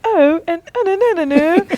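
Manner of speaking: high, loud mocking singsong; mock accent going up. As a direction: send the pitch way up, imitating a bad accent. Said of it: "they, they go up".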